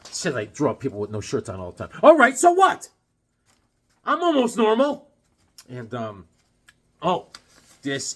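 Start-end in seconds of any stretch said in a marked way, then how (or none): 2.0-5.1 defensive outburst. Real, not acted. Defensive retort to viewer.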